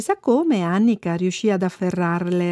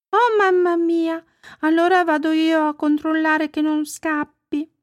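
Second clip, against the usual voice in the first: put-on worried little girl; 8 semitones higher than usual. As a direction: exclaim like a concerned child, pitched up. Bambina allarmata risponde alla mamma. (voce acuta da bambina preoccupata)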